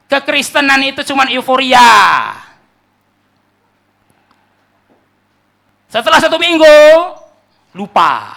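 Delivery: loud, high, drawn-out proclamation; full-force preaching. Acted. Preacher warning congregation about short-lived euphoria, full-force preaching. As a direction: hammer each phrase out to the congregation.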